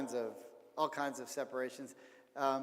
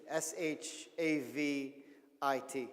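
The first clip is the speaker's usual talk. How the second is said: spelled letter by letter; much slower than usual. Shown as a demonstration. Spelling out author's name.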